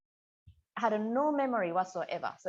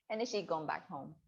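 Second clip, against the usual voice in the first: normal talking voice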